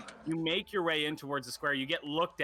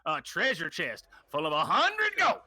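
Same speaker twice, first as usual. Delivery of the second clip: put-on a rambling country old man; old timer accent. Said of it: "that was a great accent".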